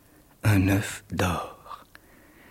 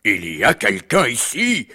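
put-on ogre voice rising louder; menacing ogre; 4 semitones higher, 5 dB louder than usual. The ogre smells an intruder, menacing giant voice. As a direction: swell into a loud, high roar. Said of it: "dit l'ogre, en roulant des yeux terribles".

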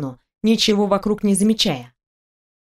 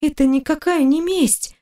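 higher-pitched, indignant female character; high protest; 6 semitones higher than usual. A narrator's reading. Wife denying revenge to her husband, high protest.

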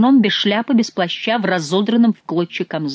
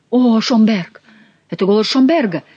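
raised, louder exclamation; narrated shout, higher and louder; excited cry; 5 semitones higher, 5 dB louder than usual. A narrator's reading. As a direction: cry out on recognizing a friend's voice. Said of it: "закричали трое миньонов".

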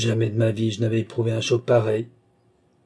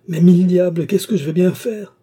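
loud, higher furious shout; furious outburst; 7 semitones higher, 7 dB louder than usual. A narrator's reading. Stuck fat man rages at the narrator, furious shout. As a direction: explode in helpless rage. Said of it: "s'abandonna à un accès de colère".

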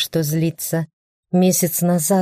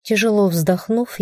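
usual speaking voice

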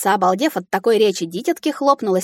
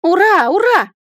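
high, loud hurrah; joyful cheer; 11 semitones higher, 6 dB louder, slower than usual. A narrator's reading. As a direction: cheer out in delight. Heroine jumps with joy.

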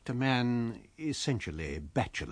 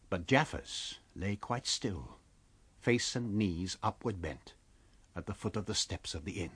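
hushed; 3 semitones lower, 6 dB quieter than usual. A narrator's reading. Solemn ending of scene.

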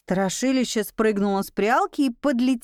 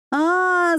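short startled cry, higher; startled yell; 7 semitones higher, 3 dB louder than usual. A narrator's reading. Heroine jumping back from the bat, yelping. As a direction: yelp in fright. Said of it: "завопила я".

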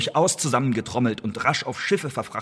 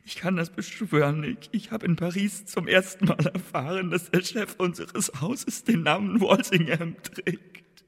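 higher, strained, pained plea, slowing to a drag; strained pleading under torture; 6 semitones higher than usual. Acted. Prisoner on the rack pleads his innocence to Walsingham, pained. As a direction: beg through the pain of the rack.

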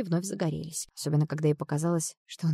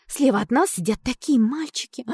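excited; 6 semitones higher, 5 dB louder than usual. Acted. Tomilka gushing about boys.

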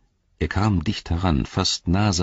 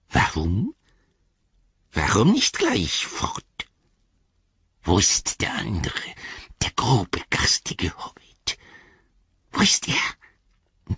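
anxious Gollum; 3 semitones higher, slower than usual. A narrator's reading. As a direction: fret like a cornered creature. (ängstliche Gollum-Stimme)

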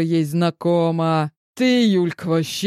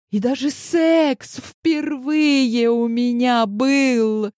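high, slow, near-shouted confession; excited outburst; 7 semitones higher, slower than usual. Acted. Heroine bursting out her secret to friends, excited high shout. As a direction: gush it out with giddy pride.

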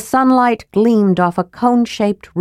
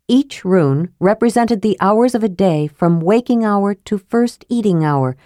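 ordinary delivery

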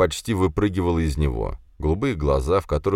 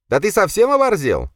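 loud, very high indignation; outraged squeal; 6 dB louder than usual. A narrator's reading. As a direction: squawk at him, affronted. Bully outraged by the hero.

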